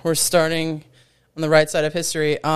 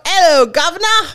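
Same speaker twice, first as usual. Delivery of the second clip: high, louder hammed-up Cockney "Hello, governor"; mock Cockney greeting; 10 semitones higher, 5 dB louder than usual. Host reveals UK week in a mock Cockney accent. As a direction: greet them like a cartoon London chimney sweep. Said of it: "hopefully by now you figured it out".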